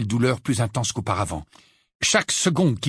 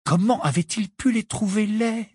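ordinary delivery, nothing marked

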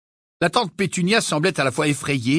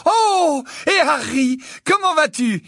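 excited; 4 dB louder, slower than usual. Acted. Mother greets Harry warmly.

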